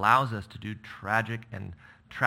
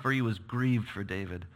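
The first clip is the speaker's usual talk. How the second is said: ordinary delivery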